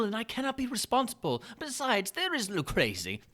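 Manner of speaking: butter him up with mock delight; Montresor's sly greeting. Montresor urges going back.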